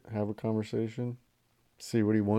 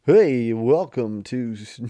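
vintage cartoon voice; 2 semitones higher, 10 dB louder, much slower than usual. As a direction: stretch it out like an old cartoon host. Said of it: "That's my radio voice".

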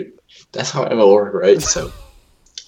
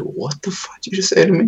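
retold incredulous demand, pitched high; restrained, higher-pitched outrage; outraged commander; 10 semitones higher, 4 dB quieter than usual. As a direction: imitate an officer in disbelief.

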